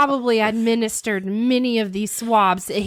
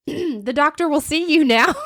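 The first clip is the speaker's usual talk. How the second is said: a higher, polite front-desk voice; mock receptionist; 6 semitones higher than usual. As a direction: play the prim receptionist calling him in. Mock receptionist calling patient.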